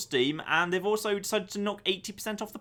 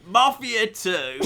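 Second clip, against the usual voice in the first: put-on Essex accent; much slower than usual. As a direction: attempt a broad Essex accent. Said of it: "the most Essex accent I could come up with".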